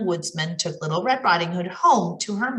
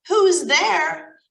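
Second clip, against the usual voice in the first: put-on high, louder old lady call through the door; old grandma calling; 8 semitones higher, 5 dB louder than usual. Grandma calls from inside to the knocking wolf, high. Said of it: "Grandma called from inside".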